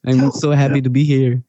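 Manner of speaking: a lightly put-on, bad French accent; mock French accent, hammed up as Michel Gondry, barely there; horrible French accent. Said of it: "That's my, uh, horrible French accent".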